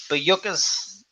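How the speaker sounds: "Bayukas" said very slowly, feeling out each syllable; slow, careful "Bayukas", unsure of the name; unsure pronunciation. Real, not acted. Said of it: "I couldn't even pronounce the name".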